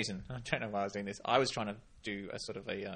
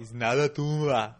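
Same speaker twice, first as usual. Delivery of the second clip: slow, loud Austrian action-star voice; Arnold Schwarzenegger; 2 semitones higher, 8 dB louder than usual. Retold or imitated. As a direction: growl it like an Austrian action hero. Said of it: "my Arnold Schwarzenegger".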